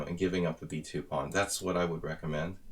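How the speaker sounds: ordinary talk, nothing marked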